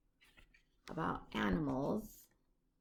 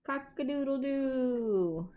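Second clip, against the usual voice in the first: higher, louder cock-a-doodle-doo; rooster crow; 6 semitones higher, 5 dB louder than usual. A narrator's reading. Recapping the book with a child, crowing the rooster's call higher and louder. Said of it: "And the rooster says".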